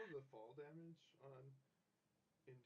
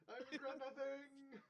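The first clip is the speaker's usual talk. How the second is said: slow, high, loud heroic line; dramatic declaration; 13 semitones higher, 8 dB louder, slower than usual. Acted. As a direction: declare it like a doomed hero. Player declares in-character, dramatically.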